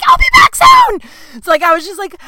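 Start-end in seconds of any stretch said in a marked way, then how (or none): 0.0-1.0 shrill high-pitched sing-song goodbye, much louder; way up high and loud, strained brightness; squeaky fake cheer. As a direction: squeal it to hide the panic. Forced cheery goodbye to boyfriend.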